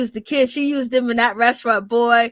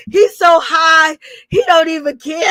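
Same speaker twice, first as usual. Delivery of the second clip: laughing; 4 semitones higher, 5 dB louder than usual. Real, not acted. Cracking up about Scrappy.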